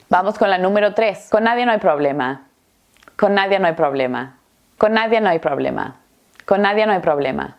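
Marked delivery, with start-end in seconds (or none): 1.3-7.5 CON NADIA NO HAY run together as "connadianoay"; fast linked dictation sentence; très rapide. Shown as a demonstration. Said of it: "Lo voy a decir muy rápido".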